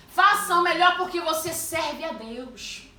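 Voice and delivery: loud, high preaching; forceful exhortation. Acted. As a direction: drive home each "Faça o melhor".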